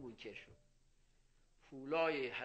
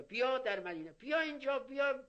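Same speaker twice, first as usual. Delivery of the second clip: calling out; 8 semitones higher than usual. Retold or imitated. Man calling him over in Medina.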